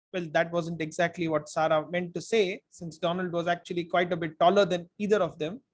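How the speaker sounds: ordinary delivery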